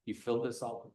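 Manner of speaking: very soft and low, unprojected; not speaking up. Shown as a demonstration. Lecturer to a full room, too quiet to hear. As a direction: say it under your breath, hard to hear. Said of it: "if you don't speak up".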